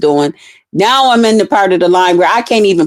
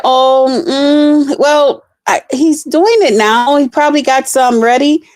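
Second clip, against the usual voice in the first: high, slow hesitant clerk voice; stall, unsure how long; 7 semitones higher than usual. Retold or imitated. Clerk hesitating about the wait, high and slow. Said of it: "She said".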